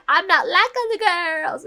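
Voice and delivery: high, loud mock-girlish boast; hammed-up send-up of the line; mocking cliché quote. Mocks a cliché game line.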